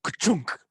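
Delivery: cartoon sound effect, punched out; mock ka-chunk sound effect. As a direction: blurt out the noise of the gate giving way. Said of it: "and I'm like".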